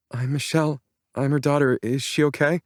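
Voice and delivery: put-on high, worried young woman's voice; anxious young woman. A narrator's reading. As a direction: ask it with fearful hope. Anxious daughter asks doctor.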